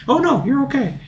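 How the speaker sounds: high, sing-song cartoon voice; hammed-up cheery reassurance amid the wreckage; a mock cartoon character insisting all is fine.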